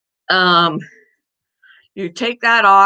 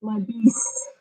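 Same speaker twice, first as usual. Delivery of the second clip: pet talk voice; slower than usual. Real, not acted. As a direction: coo at the dog. Greeting the dog.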